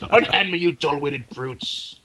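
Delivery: slow, grand villain line; hammy stage actor. Acted. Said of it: "That's a great line".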